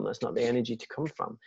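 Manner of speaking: normal talking voice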